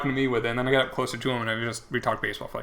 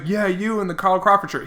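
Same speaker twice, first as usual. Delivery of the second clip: imitating a heckler singling someone out, pitched up; megaphone heckler; 6 semitones higher than usual. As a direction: call out at a higher pitch to a passer-by. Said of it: "make your voice really loud".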